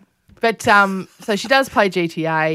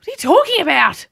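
high, louder retold bafflement; incredulous parent; 9 semitones higher, 5 dB louder, faster than usual. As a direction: act out a mum who can't believe it. Mother retells bafflement at zombie fear.

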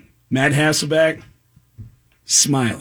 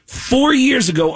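normal talking voice

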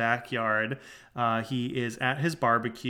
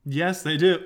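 ordinary talk

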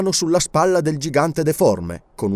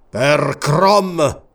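a sharp outcry by the barbarian king; exclaimed oath; 3 semitones higher, 4 dB louder than usual. A narrator's reading. King to his servant, a raised exclamation. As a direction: burst out with a mild oath. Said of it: "esclamò".

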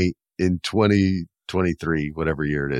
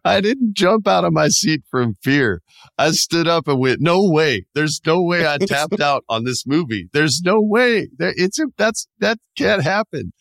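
mock disbelief; 8 semitones higher than usual. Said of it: "retelling own reaction".

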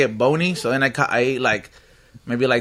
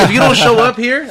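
mock threat; 8 semitones higher, 6 dB louder than usual. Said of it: "I told him, I was like".

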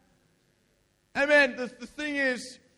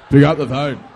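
put-on low, loud, gruff boy voice; mock macho shout; 7 semitones lower, 13 dB louder than usual. Shown as a demonstration. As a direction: bark it like a tough guy. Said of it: "like a boy".

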